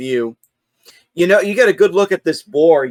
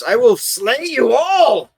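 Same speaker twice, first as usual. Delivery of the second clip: high, drawn-out mock war cry; hobgoblin battle cry; 8 semitones higher, slower than usual. Game master's hobgoblin threatens the party, high mock shout. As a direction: shout it slowly, pitch soaring on the threat. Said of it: "the hobgoblin shouts".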